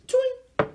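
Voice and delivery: high twangy sound effect; imitated sound effect. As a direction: mimic the anime sound. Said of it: "Just like".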